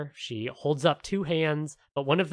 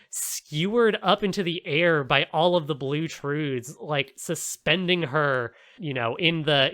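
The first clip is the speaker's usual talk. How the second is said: usual speaking voice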